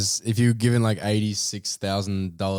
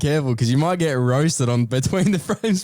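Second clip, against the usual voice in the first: mock warning; 7 semitones higher than usual. Joking warning to the stranger.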